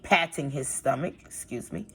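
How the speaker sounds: usual speaking voice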